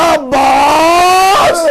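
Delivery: a long, drawn-out sobbing cry, very slow; anguished cry. Acted. Zainab crying out for her brother, an anguished drawn-out wail. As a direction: let the name tear out of you as a wail. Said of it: "Zainab के मुंह से बेसाख्ता निकला".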